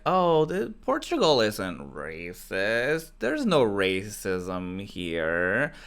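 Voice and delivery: drawn-out mock politician voice, swooping in pitch; slow, exaggerated denial, imitating politicians; dismissive politicians. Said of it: "politicians were like".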